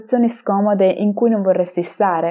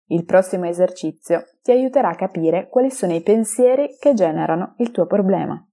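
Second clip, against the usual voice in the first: ordinary talk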